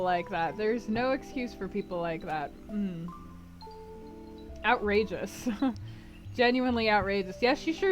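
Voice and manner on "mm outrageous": ordinary delivery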